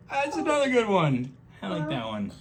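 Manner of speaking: high delighted squeal. Real, not acted. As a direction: squeal it with pleasure. Delighted aside.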